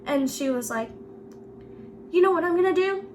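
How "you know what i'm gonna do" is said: lightly put-on scolding teacher, pitched up; teacher's threat. Quotes the angry teacher's threat.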